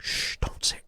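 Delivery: soft voiceless shush; hushing whisper. Retold or imitated. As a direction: whisper a warning to stay silent. Hiding, hushing each other.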